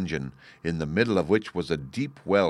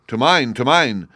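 cheeky shepherd answer; 4 semitones higher, 7 dB louder than usual. A narrator's reading. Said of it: "was the answer".

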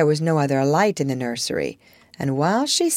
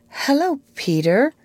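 ordinary delivery

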